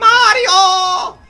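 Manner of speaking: very high, loud shouted Mario; cute Mario voice. Retold or imitated. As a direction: yell the name like cartoon Mario. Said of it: "Charles Martinet".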